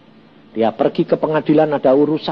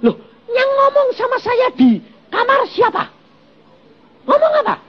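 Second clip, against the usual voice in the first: wife's retort. Retold or imitated. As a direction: protest as the wife, pitched high. Wife retorts to glaring husband. (suara istri tinggi dan ngotot)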